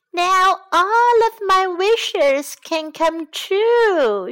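high, loud and slow with delight; overjoyed squeal; excited cry. A narrator's reading. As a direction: cry out like a thrilled little mouse. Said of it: "she cried".

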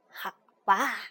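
put-on low old grandpa toad. A narrator's reading.

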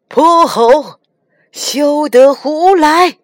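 master's stern reprimand; stern scolding. Acted. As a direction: scold the unruly disciple, drawn out. Tang Seng scolds Monkey.

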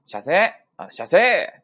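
loud casual shop welcome, squashed short; izakaya staff greeting. Acted. Small-tavern staff greet a guest, loud call. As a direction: belt out a nonstandard irasshaimase to customers. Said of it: "这种感觉呢".

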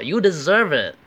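high, loud taunt with swooping pitch; mock "serves you right". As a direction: jeer like a gloating classmate. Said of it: "你是活该". (模仿同学幸灾乐祸地说“活该”)

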